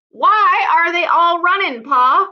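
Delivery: put-on high, loud child's question; a boy calling up to his father; young boy calling out to his father; curious boy.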